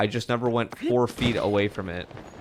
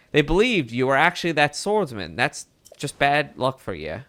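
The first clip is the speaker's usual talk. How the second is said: put-on old-timey Mid-Atlantic reporter voice; Mid-Atlantic accent; 2 semitones higher than usual. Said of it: "my, uh, Mid-Atlantic act-, accent".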